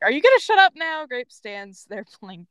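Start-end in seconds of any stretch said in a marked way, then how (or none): 0.0-1.0 fast, raised, loud demand; acted exasperation at speed; growled threat. A narrator's reading. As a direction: fire off an irritated threat, higher and louder. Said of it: "Scarlet growls at her".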